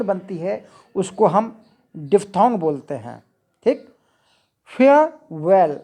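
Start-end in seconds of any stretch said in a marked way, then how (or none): 4.6-5.8 "फेयरवेल" slow in two beats, no R; silent R demo. Shown as a demonstration. Teacher repeating the example, "फेयरवेल" slowly with silent R. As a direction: draw out "फेयरवेल" as फेय, वेल without the R. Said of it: "आर silent है".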